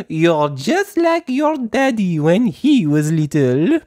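high, slow, fluttery French teacher voice; a gentle teacher speaking slowly to little children; Madame Gazelle voice. Acted. Teacher to her playgroup, high and slow.

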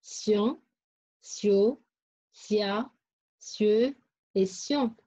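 sion, sio, sia, sieux, sion each apart with an s sound; syllable drill. Shown as a demonstration. Teacher recapping syllables for pupils, spaced sion, sio, sia, sieux, sion. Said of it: "comme les syllabes".

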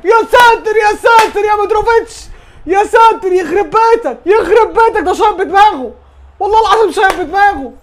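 high, loud alarmed yelling; exasperated mom scolding. Real, not acted. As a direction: shout in alarm at the screen. Dangerous header on goal.

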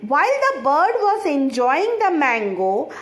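ordinary talk

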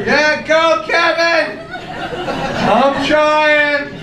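high-pitched, straining cheerleader yell; hammed-up high cheering cry; mock sideline cheer. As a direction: imitate a lover cheering like a fan. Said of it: "when I heard my name from the sidelines".

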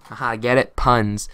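loud laugh over the joke; loud laughing punchline; laughing at own pun. Real, not acted. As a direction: crack up at the pun.

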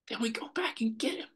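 hushed, breathy whisper, high and soft. Retold or imitated. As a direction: whisper the promise gently. Retelling what he told client.

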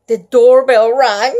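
plain reading voice

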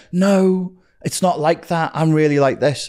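high, protesting retold plea; arguing his case. Him defending himself to his partner, high protesting imitation. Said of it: "I was trying to argue the case".

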